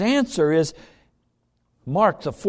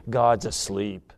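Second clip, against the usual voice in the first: low hushed refrain; 10 semitones lower, 3 dB quieter than usual. Acted.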